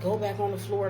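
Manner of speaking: loud, condescending mock order; talking down. Supervisor sending worker back like a kid, talking down. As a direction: order someone about like a child. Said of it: "she talking to me like I'm a kid".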